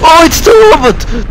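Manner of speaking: very high thrilled cry; overjoyed. Real, not acted. Gamer seeing the robot return, overjoyed cry. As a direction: shout with relief and joy.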